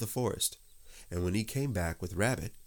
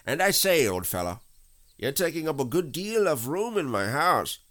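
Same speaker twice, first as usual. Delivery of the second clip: higher, louder chummy rabbit voice; breezy Rabbit; 7 semitones higher, 5 dB louder than usual. Acted. Rabbit asks Pooh for his back legs, breezy. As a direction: chat on cheerily with lifted, swooping pitch.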